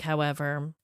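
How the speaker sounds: normal talking voice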